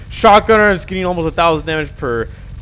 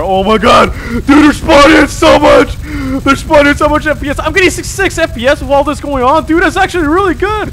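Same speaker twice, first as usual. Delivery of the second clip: high, loud, fast excited yelling; excited shouting; 10 semitones higher, 8 dB louder than usual. Real, not acted. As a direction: shout in amazed disbelief. Enemies flood the screen.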